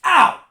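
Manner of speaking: real yelp of pain. Real, not acted. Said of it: "slapped myself".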